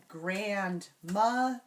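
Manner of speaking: GRANDMOTHER slowly split into three beats; syllable by syllable. Shown as a demonstration. Teacher isolating the middle syllable, grandmother slowly split. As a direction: separate grandmother into grand, muh, ther. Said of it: "syllable in the middle".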